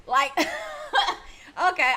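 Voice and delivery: slow, high laugh through okay. Real, not acted. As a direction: laugh it off in disbelief. Laughs at the irony.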